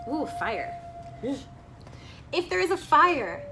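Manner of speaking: ordinary delivery, nothing marked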